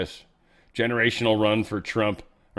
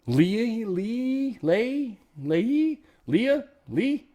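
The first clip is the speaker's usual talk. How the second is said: high, swooping tries at a name; sing-song guesses, each swept up high; trying out pronunciations; 10 semitones higher, much slower than usual. Real, not acted. Host sounding out a state rep's name, playful guessing.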